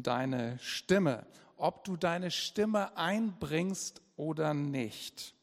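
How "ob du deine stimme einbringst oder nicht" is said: ordinary delivery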